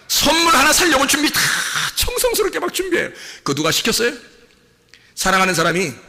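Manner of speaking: ordinary talk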